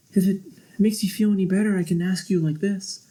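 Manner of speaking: high, fast sarcastic offer; mock him with fake politeness. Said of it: "kinda sarcastically".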